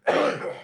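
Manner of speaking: sudden hacking cough; real cough, harsh and unvoiced; chesty cough. Real, not acted. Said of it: "I can't stop coughing tonight".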